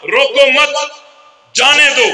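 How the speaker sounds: "रोको मत" run together, pause, then "जाने दो"; pause after मत. Shown as a demonstration. Teacher writing a note for the student, pause after मत. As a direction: break after मत, not after रोको. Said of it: "वक़्फ़ को आगे पीछे करने से माना बदल जाता है".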